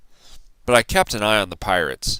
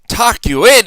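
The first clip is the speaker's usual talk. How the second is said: much higher, loud bellow; 12 semitones higher, 9 dB louder than usual. A narrator's reading. Braidbeard refusing the boy, loud bellow. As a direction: bellow it in disbelief. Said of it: "he bellowed".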